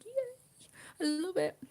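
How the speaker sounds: high, loud happy yay; a happy burst of delight; excited cheer. Retold or imitated. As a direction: squeal it with joy. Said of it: "I was like".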